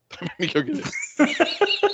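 teasing slow warning; slow mock threat. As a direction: stretch it out like a joking threat. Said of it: "That is the most unusual threat".

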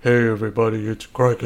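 lightly put-on intro voice; mock YouTuber intro voice; fake voice. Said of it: "Put on a fake voice".

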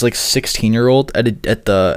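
ordinary talk, nothing marked

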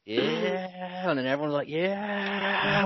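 high, soft drawn-out cheer; a hushed, high imitation of a crowd's yeah; mock crowd cheer. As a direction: mimic the whole end going yeah, up high.

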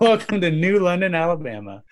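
put-on welcome with a laugh at the end; mock town welcome. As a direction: announce it like a town greeter.

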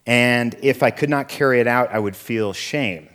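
usual speaking voice

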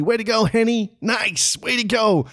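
high, excited imitated cheers; cheering teammates. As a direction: call out congratulations like teammates on the ice. Teammates congratulate him after goal.

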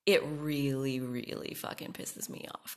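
real anger dropped to a whisper; seething. Real, not acted. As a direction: hiss it under your breath, furious. Building anger over online harassment.

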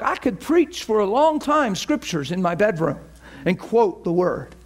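ordinary talk, nothing marked

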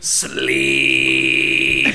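low, put-on one-word command; mock villain command. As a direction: drop it low and deadpan. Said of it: "And he goes".

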